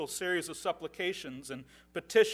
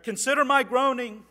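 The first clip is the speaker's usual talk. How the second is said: proclaimed scripture; 5 semitones higher, 11 dB louder than usual. Acted. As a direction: read out loud and strong as a plea. Reads psalm aloud.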